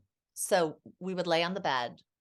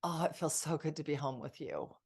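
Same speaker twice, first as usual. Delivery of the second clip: tender whisper-soft; 4 semitones lower, 5 dB quieter than usual. Retold or imitated. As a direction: murmur it like to a husband just home. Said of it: "It was".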